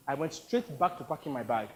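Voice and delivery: plain reading voice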